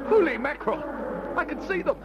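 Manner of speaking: high-pitched alarmed cry; horrified lookout raising the alarm; rising panic. Acted. Sees lions coming at them.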